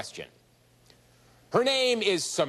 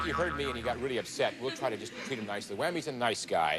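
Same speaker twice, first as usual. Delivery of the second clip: hushed mock soothing; softly calm the whammy; 4 semitones lower, 6 dB quieter than usual.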